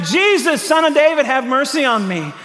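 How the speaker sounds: high-pitched imitation of a shouted plea; retold shout. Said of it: "he began shouting".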